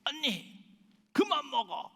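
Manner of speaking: high-pitched acted wail of grievance; tearful, resentful. Youngest sister yelling at big sister over chicken, mock tearful resentment. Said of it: "울음 섞인, 원망 섞인, 억울하다고 하는".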